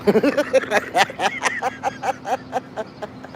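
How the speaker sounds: high airy wheeze of laughter; wheezy laugh. Real, not acted. Laughing at his own Moloch pun, wheezing.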